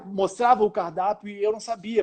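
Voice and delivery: ordinary talk, nothing marked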